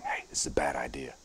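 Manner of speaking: whispered warning from within; voiceless, soft whisper as the body's warning. Acted. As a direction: say it in a hushed whisper. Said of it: "'Cause if it's whispering".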